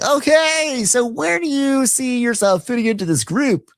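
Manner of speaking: very high campy host voice; a sing-song host question; mocking Andy. Host Andy asking Shamia, high campy impression. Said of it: "Andy's like".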